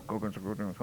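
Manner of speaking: low, soft muttered "Gogudzo, gogudzo"; muttering. Shown as a demonstration. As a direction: grumble "gogudzo" low under your breath. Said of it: "that's a typical murmuring".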